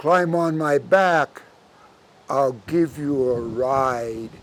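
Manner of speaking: slow cow voice, sinking low; cow character. Acted. Cow offering the girl a ride, slow and low. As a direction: draw it out, dropping low at the end.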